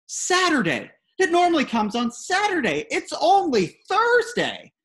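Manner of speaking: high, loud, slowing panicked dad voice; mock-frantic, high and loud, slowing on the last words; frantic insistence. Father alarmed, insisting on schedule.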